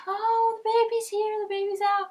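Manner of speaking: very high, loud, joyful; joyful announcement. Retold or imitated. Nurses tell the mother her baby arrived, high and joyful. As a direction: announce it like the best news. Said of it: "and they're like".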